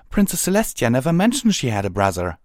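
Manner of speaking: high, rushed disbelief; astonished protest. Acted. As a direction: blurt out the objection, flustered. Twilight protests the astonishing news.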